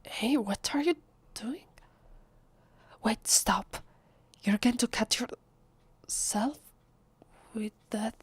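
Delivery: alarmed warning. Acted. Vampire stopping a visitor from cutting themselves, alarmed. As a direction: break off in alarm mid-warning.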